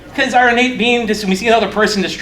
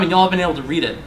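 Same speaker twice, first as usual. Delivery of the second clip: ordinary talk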